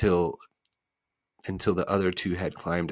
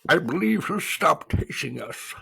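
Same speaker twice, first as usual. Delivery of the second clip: higher, louder voice for the bug; humbug character voice; 10 semitones higher, 5 dB louder than usual. A narrator's reading. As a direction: play the humbug with a lifted, put-on voice.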